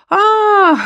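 sharp high "ah!"; sudden realization. Acted. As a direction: it just dawned on him.

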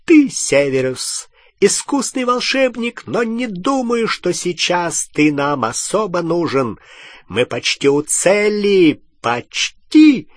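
high, clear villain voice; Voldemort. A narrator's reading. Dark lord dismisses his servant's help, high clear voice. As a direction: pitch it far up, rising to a loud peak. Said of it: "отозвался Волан-де-Морт высоким, ясным голосом".

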